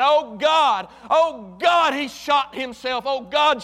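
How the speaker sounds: loud, high panicked screams; screaming mother. Retold or imitated. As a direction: scream it out in terror.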